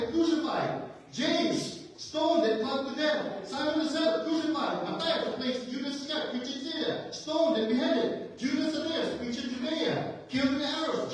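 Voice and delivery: high, urgent roll call of martyrs; impassioned preaching. Real, not acted. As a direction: hammer out each name and death, pitched high. Preacher lists martyrs' deaths.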